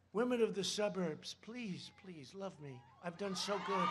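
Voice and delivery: sarcastic soft-voiced appeal; joking plea. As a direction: plead softly, as a joke. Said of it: "I joke and I say".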